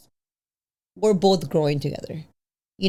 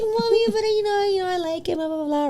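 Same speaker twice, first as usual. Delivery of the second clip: put-on much higher, whiny childish pleading; whiny plea; 12 semitones higher than usual. Mom pleading with her daughter about a guy, mock whiny. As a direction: whine like a lovesick kid defending a crush.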